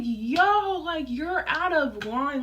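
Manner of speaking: high, indignant retold outburst; indignant protest. Daughter telling off the caller who insulted her mom, retold indignation. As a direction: protest loudly, stretching it out.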